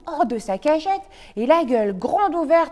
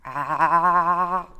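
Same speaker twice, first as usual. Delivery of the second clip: open-mouthed ah; 6 semitones lower than usual. Acted. Wolf opens mouth.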